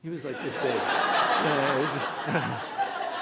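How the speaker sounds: high, laughing through the words. Retold or imitated. Telling the beach crowd the shark's size, laughing. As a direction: sheepishly laugh out how small it was.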